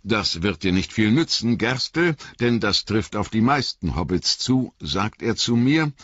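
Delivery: lightly voiced garrulous innkeeper, rambling; a talkative landlord spinning his tale; chatty innkeeper. A narrator's reading. Innkeeper rambling on to Frodo, lightly voiced.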